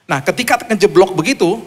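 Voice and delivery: ordinary talk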